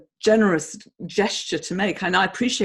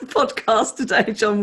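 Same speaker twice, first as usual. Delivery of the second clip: louder, laughing through the words; emotional laughter; 3 semitones higher, 5 dB louder than usual. Real, not acted. Host laughing to guest about emotional podcast. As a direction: laugh at how moved you both are. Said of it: "now we're both getting emotional".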